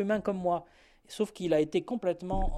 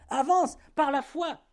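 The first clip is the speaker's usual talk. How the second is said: urging call; 8 semitones higher, 4 dB louder than usual. Acted. Voice urging pilgrim onward.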